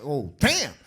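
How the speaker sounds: normal talking voice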